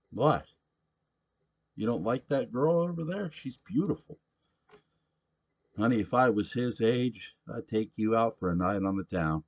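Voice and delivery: put-on loud, high rowdy drunk voice; a belligerent barfly demanding his beer; drunk pushy patron. Sam teasing and flirting.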